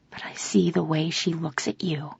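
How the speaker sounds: very soft, near-whisper. A narrator's reading. Woman whispering her jealousy to her partner. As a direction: drop to a hushed confession. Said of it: "I whisper".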